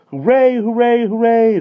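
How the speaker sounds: very high, loud cheers; children cheering an outing; joyful shout. A narrator's reading. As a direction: cheer with delight. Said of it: "they shouted".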